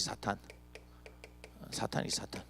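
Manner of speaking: a whispered, hushed 사탄. Real, not acted. 사탄을 언급하며 목소리를 죽여 속삭이는 목사. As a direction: breathe the word out without voice.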